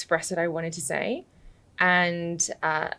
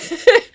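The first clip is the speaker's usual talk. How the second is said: short laugh; 10 dB louder than usual. Real, not acted. Laughing about getting aggressive.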